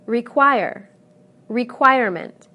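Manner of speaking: re-QUIRE, re-QUIRE-ment; loud second syllable in Require and requirement; same stress. Shown as a demonstration. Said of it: "the stress remains the same".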